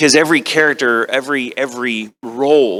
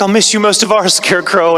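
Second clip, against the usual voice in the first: higher, louder film-heroine imitation; hammed-up tearful-farewell line, pitched up; Dorothy; 8 semitones higher, 6 dB louder than usual. Actor quotes Wizard of Oz farewell, mock-sentimental imitation. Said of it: "Wizard of Oz when she's like".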